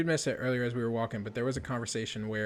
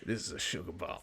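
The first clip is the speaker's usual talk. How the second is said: lower, softer sugar bomb voice; 6 semitones lower, 6 dB quieter than usual. Shown as a demonstration. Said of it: "use the sugar bomb voice".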